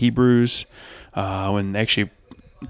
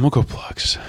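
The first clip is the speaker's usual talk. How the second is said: normal talking voice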